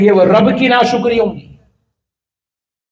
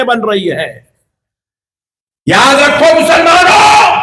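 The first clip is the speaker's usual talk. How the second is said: preaching at full force; 8 semitones higher than usual. Acted. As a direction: thunder it out at the congregation.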